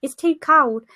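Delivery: hammed-up British accent moan; mock British accent. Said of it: "Spot on with the British accent there".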